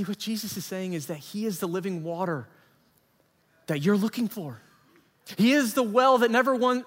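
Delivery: normal talking voice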